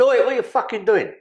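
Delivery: loud, high angry yell at a thief; angry shout. Retold or imitated. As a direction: bark it like a furious market man catching someone. Geezer catches him at lorry.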